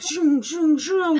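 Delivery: loud, high zooming noises; punchy repeated zoom effect; imitated racers zooming. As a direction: whoosh like racers flying past. Imitates racers speeding past.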